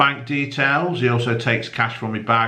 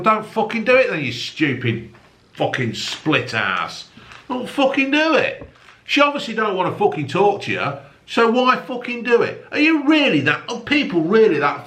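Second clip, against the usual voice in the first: higher, exasperated ranting; angry rant; 10 semitones higher than usual. Real, not acted. Ranting at the problem-page writer, much higher and exasperated. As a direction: hammer it home, fed up.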